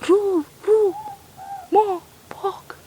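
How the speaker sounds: high hooting ruru owl call. Retold or imitated. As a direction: hoot it like a night owl. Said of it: "It sounds like he's saying his own name".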